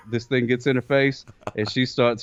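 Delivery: normal talking voice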